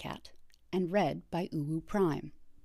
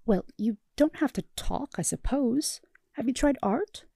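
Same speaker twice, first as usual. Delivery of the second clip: higher, overly upbeat suggestion; strained patience; 6 semitones higher, 4 dB louder than usual. Acted. Therapist hiding dwindling patience, forced cheer. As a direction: cover the irritation with cheer. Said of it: "trying not to express his dwindling patience".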